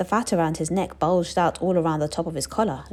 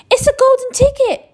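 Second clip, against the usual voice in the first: high, loud excited scream; 10 dB louder than usual. Acted. Shopkeeper screams at Charlie's golden ticket, high and loud. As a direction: shriek it out in amazement. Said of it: "screamed the shopkeeper".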